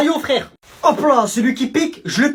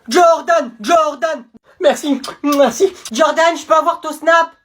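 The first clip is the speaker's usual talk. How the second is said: loud, high-pitched gushing; excited, raised cheering; fan adulation; 6 semitones higher, 5 dB louder, slower than usual. Acted. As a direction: call out like a starstruck fan. Crowd gushes over hero Jordan.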